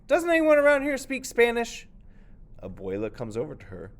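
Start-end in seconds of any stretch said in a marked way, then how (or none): 0.1-1.9 high, loud, exasperated cry; frustrated child. Acted. Nieto, fed up nobody speaks Spanish, loud and high. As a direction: throw up your hands in frustration. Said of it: "Nieto, frustrated".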